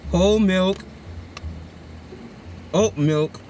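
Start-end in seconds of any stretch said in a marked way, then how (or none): none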